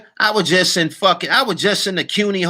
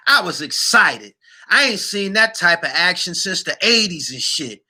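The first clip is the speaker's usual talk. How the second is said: normal talking voice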